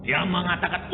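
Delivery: normal talking voice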